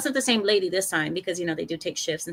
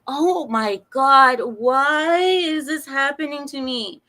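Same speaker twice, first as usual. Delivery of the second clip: exasperated wail; 4 semitones higher, 5 dB louder, slower than usual. Retold or imitated. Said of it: "I'm like".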